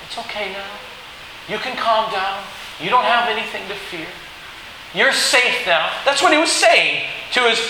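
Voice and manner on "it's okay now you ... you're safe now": gentle, lifted comforting voice; tender. Acted. Joseph telling frightened brothers they are safe, tenderly. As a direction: comfort a small child softly. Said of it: "like I do my little Gracie".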